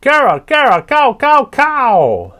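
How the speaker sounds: very high, loud parrot squawk; raucous croak. Acted. Papagayo greeting the morning, raucous high parrot squawk. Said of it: "raucous croak".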